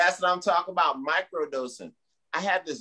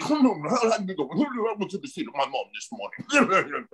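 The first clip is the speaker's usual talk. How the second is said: put-on British accent. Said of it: "those British dudes like".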